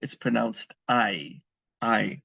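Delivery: hay said just like English I, no H; hay rhymes with eye, never hey; pronounced I. Shown as a demonstration. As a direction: say hay as a plain I sound, silent H. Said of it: "it's not hey".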